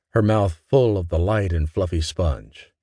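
ordinary delivery, nothing marked

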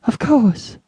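high-pitched hysterical cry; a frightened little yelp, then hurried assent. A narrator's reading. Terrified nurse answers Mister Button, hysterical and high. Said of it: "she cried hysterically".

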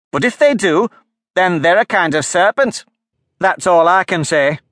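high, loud, accusing bird voice; scornful pigeon. A narrator's reading. Pigeon accuses Alice of being a serpent, scornful. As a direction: lecture her like a suspicious pigeon.

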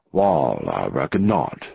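very low outlaw boss voice; low-voiced gang leader. A narrator's reading. Gang leader agrees with the visitor, low-pitched and private. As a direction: drop right down deep for the boss.

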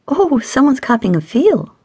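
high, singsong tease; teasing. A narrator's reading. Stacy catching the couple touching, teasing. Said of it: "Stacy teased".

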